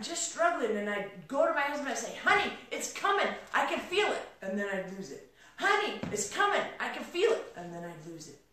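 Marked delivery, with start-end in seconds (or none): 2.2-4.3 high, eager re-enacted promise; excited anticipation. Wife telling husband it's coming, excited. 5.6-7.5 high, eager re-enacted promise; excited anticipation. Wife telling husband it's coming, excited. Same plea acted again.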